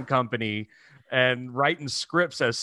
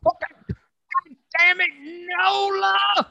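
very high, drawn-out mock shriek; hammed-up motherly outrage; exasperated mom impression; slower than usual. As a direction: wail the curse like an outraged mom. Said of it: "my mom goes".

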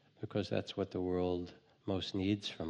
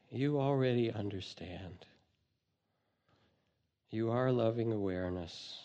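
guided meditation. Acted. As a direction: calmly lead listeners inward.

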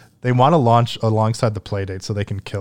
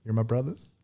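ordinary delivery

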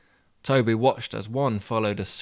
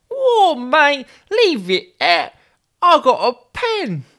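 put-on much higher, slow London boy's voice; high-pitched young boy; 9 dB louder, slower than usual. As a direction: play a cheeky thirteen-year-old. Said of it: "therefore high-pitched voice".

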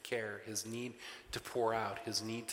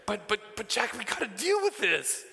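laughing plea; 11 semitones higher, 5 dB louder than usual. Retold or imitated. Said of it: "pleading with son".

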